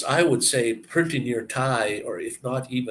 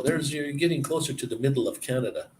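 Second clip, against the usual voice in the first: ordinary talk, nothing marked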